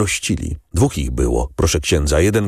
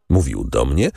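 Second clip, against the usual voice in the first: plain reading voice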